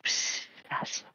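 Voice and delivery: hushed unvoiced whisper. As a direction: breathe it out as a whisper. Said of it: "you hear things like".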